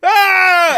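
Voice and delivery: long high-pitched loud "ah" scream; mock child's yell. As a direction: imitate a boy bursting in screaming. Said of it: "Opie comes in yelling".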